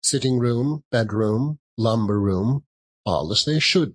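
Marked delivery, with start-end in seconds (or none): none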